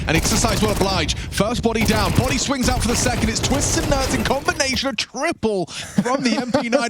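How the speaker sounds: rising excited call; building hype. Acted.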